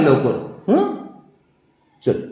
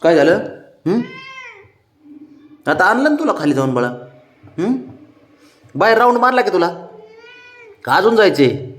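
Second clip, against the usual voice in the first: high, loud sing-song coaxing; 11 semitones higher, 5 dB louder than usual. Real, not acted. Man coaxing his sulking pet before leaving, high baby talk.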